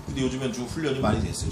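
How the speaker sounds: normal talking voice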